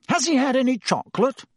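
high-pitched anxious question. A narrator's reading. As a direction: fuss anxiously over the patient. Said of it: "he asked Madame Pomfrey anxiously".

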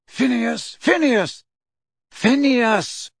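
high repeated calling; shouting a name. Acted. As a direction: call out from the frames. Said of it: "some of them shouted his name too".

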